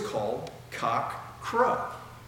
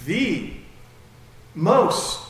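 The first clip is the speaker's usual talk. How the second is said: louder and higher on the most; emphatic most; 6 semitones higher, 8 dB louder than usual. Acted. Preacher stressing Peter's self-image, loud on "most". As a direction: punch "most" hard and high.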